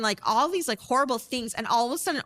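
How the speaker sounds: normal talking voice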